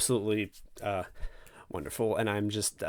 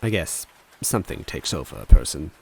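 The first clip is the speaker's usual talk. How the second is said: lower, measured first-person storytelling; story narration; 4 semitones lower, 4 dB louder than usual. A narrator's reading. Reading the Mario is Missing chapter to viewers, lower measured narration.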